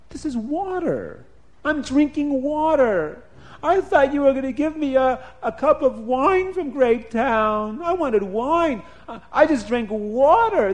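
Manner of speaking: a cheated king protests; outraged king. Acted. King tastes water, outraged.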